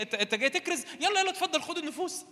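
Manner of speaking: high-pitched, sarcastic welcome; mock devil. Devil sarcastically invites evangelist to take souls, high mocking voice. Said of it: "إبليس يقول لي".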